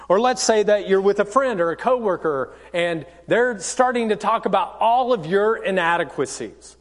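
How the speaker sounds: normal talking voice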